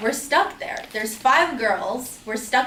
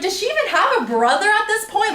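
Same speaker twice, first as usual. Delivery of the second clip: much higher, louder outraged question; incredulous rant; 9 semitones higher, 4 dB louder than usual. Retold or imitated. Doubting Kim's story, in much higher, louder disbelief. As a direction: demand it in disbelief. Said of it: "I'm like".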